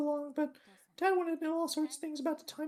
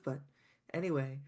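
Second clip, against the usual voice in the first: usual speaking voice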